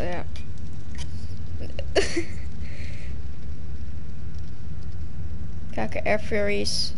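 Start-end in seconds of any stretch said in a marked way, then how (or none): 0.3-5.7 loud laughter. Real, not acted. Laughing at old TikTok.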